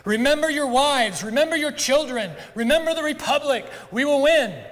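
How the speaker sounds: rallying battle cry. Acted. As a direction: rouse the troops to charge.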